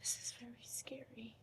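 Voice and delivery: unvoiced, trembling admission of fear; afraid whisper. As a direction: barely get the words out, afraid. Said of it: "You want me to act it out?".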